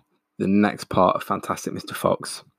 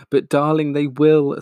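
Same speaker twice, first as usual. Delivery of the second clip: sobbed; 6 semitones higher, 4 dB louder than usual. Acted. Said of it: "sobbed Missus Fox".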